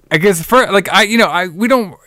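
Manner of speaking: usual speaking voice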